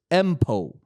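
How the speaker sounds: "M pole" as two plain syllables; said as a word. Shown as a demonstration. Technician teaching the term, "M pole" said as one word. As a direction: say "M pole" like a word, not spelled out. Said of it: "how you say it is".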